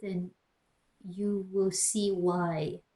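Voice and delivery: ordinary talk, nothing marked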